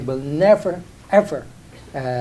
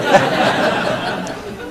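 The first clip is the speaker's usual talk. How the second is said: laughing. Real, not acted. Laughing while telling interviewer about the press line. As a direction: laugh it off, amused.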